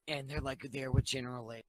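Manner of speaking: ordinary talk, nothing marked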